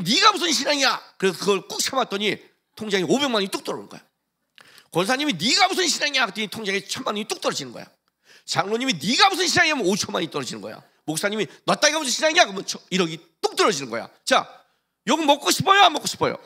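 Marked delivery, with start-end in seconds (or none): 0.0-1.1 loud, higher scornful jab; mock taunt. Taunt repeated. 5.3-6.3 loud, higher scornful jab; mock taunt. Taunt repeated. 8.9-9.9 loud, much higher scornful shout; hammed-up jeering yell; mock taunt. Elder taunts, rising. 11.6-12.4 loud, much higher scornful shout; hammed-up jeering yell; mock taunt. Pastor taunts.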